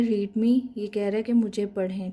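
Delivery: ordinary delivery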